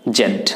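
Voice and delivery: "জেড" as British zed, low and set apart. Shown as a demonstration. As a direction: model জেড with a closing d. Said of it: "according to the British accent you have to say it".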